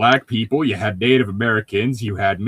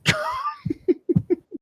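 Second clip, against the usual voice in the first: high burst of laughter; 4 dB quieter than usual. Real, not acted. Teller laughing at her own retelling, a high laugh. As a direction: laugh at the absurdity.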